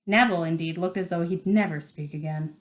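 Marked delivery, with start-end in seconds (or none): none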